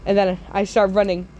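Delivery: usual speaking voice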